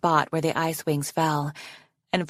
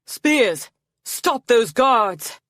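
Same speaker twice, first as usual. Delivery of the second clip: loud, high, drawn-out command; shouted command; 6 semitones higher, 5 dB louder, much slower than usual. A narrator's reading. Arctic commanding the spears against his pursuers, shouting. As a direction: shout the order with force. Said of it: "and shouted".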